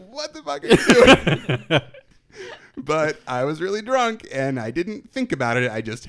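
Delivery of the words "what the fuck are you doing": high, loud, drawn-out exclamation; imitating a baffled crowd at full volume; mock-outraged disbelief. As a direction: yell it like an appalled onlooker, laughing. Imagined reaction of stunned onlookers.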